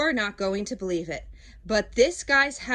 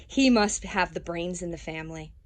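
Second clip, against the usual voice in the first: ordinary delivery, nothing marked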